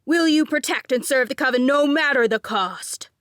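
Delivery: loud, high commanding demand; near yell. A narrator's reading. Grant nearly yells oath at Nadine. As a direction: yell it like a drill sergeant. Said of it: "Grant practically yelled".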